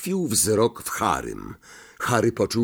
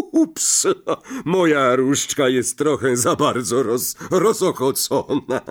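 oops; 3 semitones higher, 3 dB louder than usual. A narrator's reading. Lockhart drops his wand.

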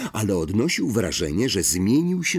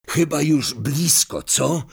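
higher, lightly croaked question; hoarse croak; 6 semitones higher than usual. A narrator's reading. Ron asks Harry after hours of flying, lightly hoarse. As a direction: ask it with a touch of hoarseness. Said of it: "zachrypiał Ron".

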